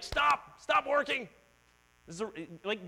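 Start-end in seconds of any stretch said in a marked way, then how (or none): 0.0-1.3 loud, high barked order; mock command. God imagined as rule-giver, loud mock order. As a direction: bark it like a strict rulebook.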